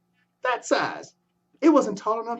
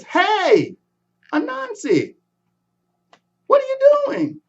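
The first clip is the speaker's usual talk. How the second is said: put-on much higher calling boy's voice; 5 dB louder than usual. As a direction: shout up the tree to the spider. Boy calls up to Anansi.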